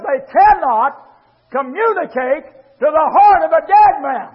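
loud, high, ringing preacher's cry; full-force preaching. Acted.

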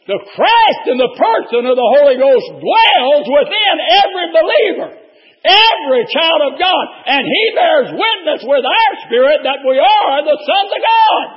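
preaching at full force. Acted. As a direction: ring it out to the congregation. Preaching climax.

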